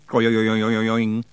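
wobbling metallic "oyoyoing"; imitated spinning lid. A narrator's reading. Narrator imitates a saucepan lid settling on the floor, wobbling. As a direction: draw out a repeating, ringing wobble.

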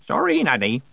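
ordinary delivery, nothing marked

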